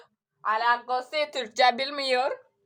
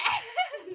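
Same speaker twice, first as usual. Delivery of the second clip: high laugh; 7 semitones higher, 7 dB quieter than usual. Real, not acted. Laugh burst.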